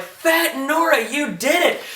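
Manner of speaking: high, excited mock congratulations; retold cheering. Marines coming through to Fett and Nora, excited. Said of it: "and they're like".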